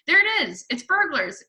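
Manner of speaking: put-on high, loud alarmed boy; cried out. A narrator's reading. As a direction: sound the alarm excitedly. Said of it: "cried the brother".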